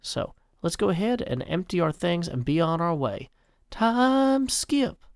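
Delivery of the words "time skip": much higher announcer-style British call. Acted. Announcing the time skip to viewers in a high announcer voice. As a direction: announce it like a trailer.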